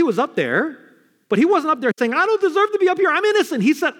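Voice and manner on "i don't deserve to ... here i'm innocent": high-pitched mock protest of innocence; indignant protest. Preacher mimicking a criminal denying guilt, high indignant protest.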